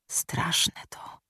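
very soft, breathy whisper. A narrator's reading. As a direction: barely breathe the words. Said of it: "szepnął Janusz".

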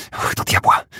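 a hissed, breathless curse. A narrator's reading. Spit out the curse under her breath. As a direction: Ashton spills the tea and curses, hissed. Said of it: "syknęła".

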